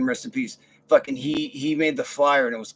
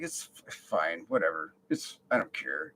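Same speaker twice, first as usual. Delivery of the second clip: softer, lower it's fine whatever; muttered brush-off; 8 semitones lower, 8 dB quieter than usual. Retold or imitated. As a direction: mumble you don't care. Brushing off apology.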